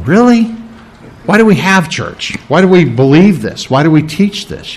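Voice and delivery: retold incredulity, pitch jumping up on the first word; incredulous retort. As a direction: challenge it with a string of baffled questions. Acts out his incredulous reply to deacon.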